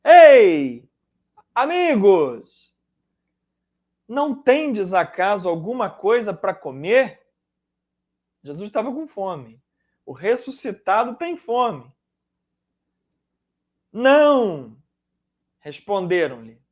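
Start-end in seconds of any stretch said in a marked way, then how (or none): 0.0-7.2 calling out from the shore; yelling across the room. Retold or imitated. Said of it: "imaginando Jesus gritando lá da praia". 13.9-14.8 calling back. Acted. Disciples answer from the boat.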